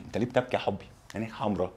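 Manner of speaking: very soft, low murmur; quiet, low, lightly acted concern; hushed sympathy. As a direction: drop right down to ask gently. Translates question to someone crying.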